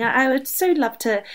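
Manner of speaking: normal talking voice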